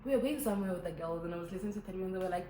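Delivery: ordinary talk, nothing marked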